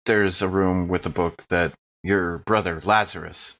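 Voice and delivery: usual speaking voice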